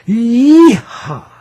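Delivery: loud high whoop; joyful yee-haw. Real, not acted. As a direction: whoop it out like a cowboy.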